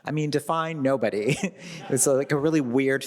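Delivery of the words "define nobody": normal talking voice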